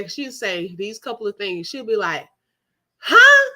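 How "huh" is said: high, loud mock mom huh; mom's huh. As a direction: play mom hearing the phone. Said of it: "that's how my mom used to".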